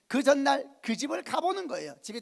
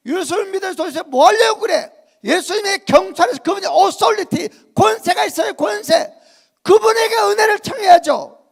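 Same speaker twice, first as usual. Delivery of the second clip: loud, higher preaching shout; a pastor urging the people to call on authority, preaching loudly; preaching at full force; 9 semitones higher, 15 dB louder than usual. Acted. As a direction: rouse the congregation about authority.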